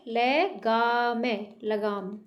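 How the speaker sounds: slow, split into syllables; ले, गा... लगाम sounded out slowly. Shown as a demonstration. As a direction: spell out le-ga-m then say लगाम whole. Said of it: "ग में आ की मात्रा है".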